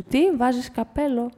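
ordinary delivery, nothing marked

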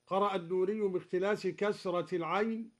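normal talking voice

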